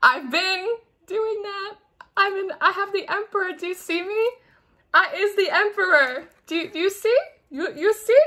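high, pleading mock-indignant voice; exasperated Sag. Sag protesting to the angels, high and exasperated. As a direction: plead to be seen, hammed-up and high. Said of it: "You're like".

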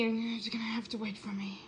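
whispered, almost no voice; whispering. Shown as a demonstration. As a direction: breathe the line without voicing. Whispered take of the line.

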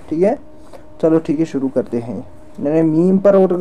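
ordinary talk

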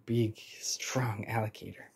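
awed whisper. Real, not acted. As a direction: breathe it out in wonder. Child whispering in awe.

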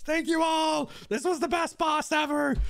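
high, jubilant shout; elated thanks. Real, not acted. Streamer thanking chat after the win, elated shout. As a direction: burst out high with joy.

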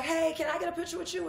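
high, eager fan voice asking for a photo; excited fan. Retold or imitated. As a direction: squeal it out like a starstruck fan. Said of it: "I was like".

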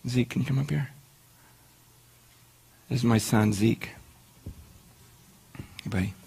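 a quiet fatherly hello; father calling his little son up front; gentle with son. Real, not acted.

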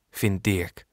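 usual speaking voice

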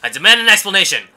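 loud, fast indignant demand. Acted. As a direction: demand an answer loudly. Voicing angry demand.

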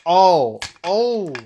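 sharp high pained cry; hit hard crying out. Real, not acted. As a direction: wince out loud at the hit. Wrestler kicked in the face.

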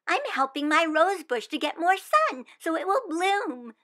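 put-on earnest little girl sure of her plan; hopeful child; eager young Wanda.

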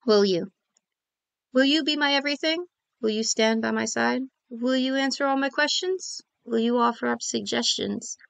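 soft, tender reading of a love poem. Acted. Poet reading her nervous proposal poem, soft and tender. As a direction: read each question gently, hopefully.

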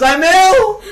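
high, loud mock wail; hammed-up crying of a sick man. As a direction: wail it high like a comic crybaby. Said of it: "crying, and he goes".

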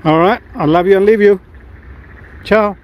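normal talking voice

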